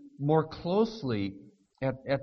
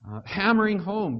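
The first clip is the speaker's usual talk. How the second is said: ordinary talk, nothing marked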